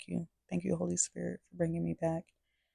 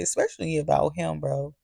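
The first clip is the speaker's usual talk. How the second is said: ordinary delivery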